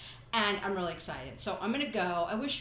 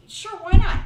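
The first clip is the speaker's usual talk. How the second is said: louder, higher careless sure why not; carefree; 10 semitones higher, 16 dB louder than usual. Retold or imitated. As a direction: toss it off, much louder. Voicing [name]'s carefree attitude.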